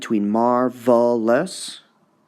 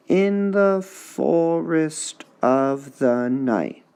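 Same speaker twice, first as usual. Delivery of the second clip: In the forest of the night, very slow, IN and FOR stressed higher; slow scanned reading; 5 semitones higher, much slower than usual. Shown as a demonstration. Teacher scanning a tricky line slowly. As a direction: stretch it out, beat by beat. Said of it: "the rest is kind of tricky".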